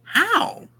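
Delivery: very high falsetto mother, puzzled; mom's high voice. Acted. Mother questioning her son about the cottage, high falsetto puzzlement. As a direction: squeak it out like a surprised mom. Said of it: "Our mom says".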